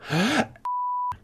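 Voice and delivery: high, quick intake of alarm; mock gasp. Player reacting to coming boats, mock gasp. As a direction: gasp in pretend horror.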